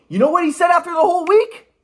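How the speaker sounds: high, loud rising question; excited build-up. Real, not acted. Setting up the doctor's verdict, high and excited.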